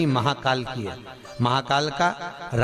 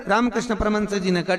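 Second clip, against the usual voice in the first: usual speaking voice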